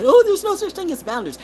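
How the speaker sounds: high mocking voice of the doubters; mocking quote. Lightly mocking people who deny boundaries. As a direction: mimic the know-it-alls. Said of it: "you have people who say".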